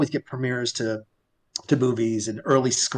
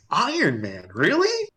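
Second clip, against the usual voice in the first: doubtful echo, then a high questioning "really?"; retold disbelief; 5 semitones higher, slower than usual. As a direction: question it sceptically, voice leaping up at the end. Teller doubts the announced film.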